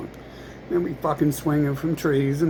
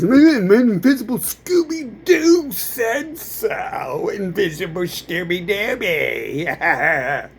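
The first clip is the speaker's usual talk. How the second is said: higher, slow goofy voice breaking into laughter; mocking silly voice; 5 semitones higher, 4 dB louder, slower than usual. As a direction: ridicule them in a dopey singsong.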